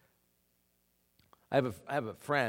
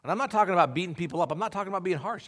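usual speaking voice

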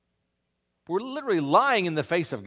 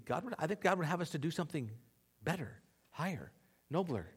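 ordinary delivery

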